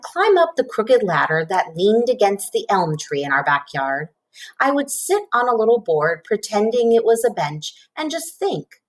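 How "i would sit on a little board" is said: usual speaking voice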